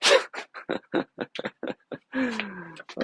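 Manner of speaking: high incredulous laugh. Real, not acted. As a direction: burst out laughing in disbelief. Laughs at the absurdity.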